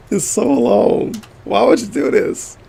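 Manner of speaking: high, drawn-out whine; mock whine. Streamer fed up with a long statement, mock whining. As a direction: whine in exaggerated complaint.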